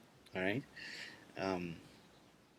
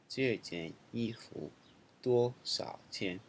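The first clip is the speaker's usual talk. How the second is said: second slow model reading; slower than usual. Shown as a demonstration. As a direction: say it again slowly and evenly. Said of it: "let's look at some example sentences".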